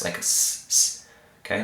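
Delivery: hissed soft S sound s, unvoiced; soft S demo. Shown as a demonstration. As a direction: stretch out a soft s like a snake. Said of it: "a soft S".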